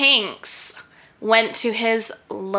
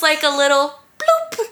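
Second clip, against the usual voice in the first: laughing; 6 semitones higher than usual. Real, not acted. Laughing while telling.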